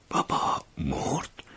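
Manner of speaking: slow hushed question rising on مرد; drawn-out بابا مرد? in shock; stunned disbelief. A narrator's reading. Narrator unable to believe his father died, slow disbelieving question.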